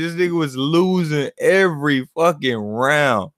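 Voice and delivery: ordinary talk, nothing marked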